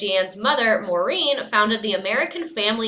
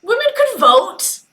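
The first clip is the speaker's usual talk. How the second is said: outraged disbelief; 9 semitones higher, 5 dB louder than usual. Real, not acted. Outraged aside.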